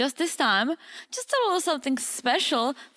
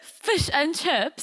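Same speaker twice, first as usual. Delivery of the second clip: slow, higher-pitched mock British; British accent; 5 semitones higher, slower than usual. Shown as a demonstration. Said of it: "I'll give you some British accent".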